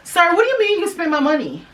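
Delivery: high, outraged "what do you mean"; indignant disbelief. Retold or imitated. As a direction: demand it back in shocked disbelief. Confronting dad who spent her card.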